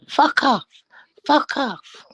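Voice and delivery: high, loud parrot swearing; high parrot squawk with falling ends; parrot impression. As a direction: mimic a cheeky parrot calling out. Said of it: "all you heard was".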